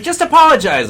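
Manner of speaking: high, loud retold demand; mock exasperated urging. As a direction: push them loudly, imitating impatience.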